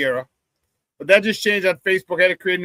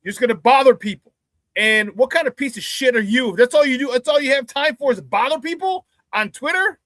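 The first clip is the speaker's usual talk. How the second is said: higher, scornful rant rising at the end; indignant; 5 semitones higher than usual. Real, not acted. Host scolding the hacker who bothers people, indignant scorn. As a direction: berate the thief in disbelief.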